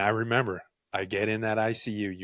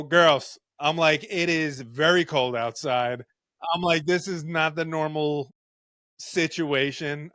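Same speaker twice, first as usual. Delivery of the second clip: louder, higher, slowed joking protest; mock formal complaint; 6 semitones higher, 5 dB louder, slower than usual. To the women EMTs with the sheet flying, louder mock protest. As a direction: announce it to the nurses with mock dignity.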